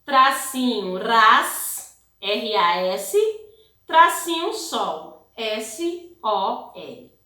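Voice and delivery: slow, each letter named separately; teacher spelling syllables slowly for the class; slow syllable and letter dictation. Shown as a demonstration. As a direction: spell out ras and sol letter by letter. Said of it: "Vamos escrever aí".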